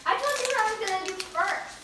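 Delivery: high, loud, insistent denial; indignant protest. Real, not acted. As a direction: shout it down, offended. Sister denies copying her.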